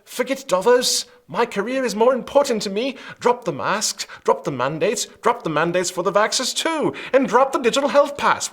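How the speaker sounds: a hammed-up Boris caving in to save his career; mock Boris Johnson. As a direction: sneer it out like a self-serving Boris. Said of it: "what does he say?".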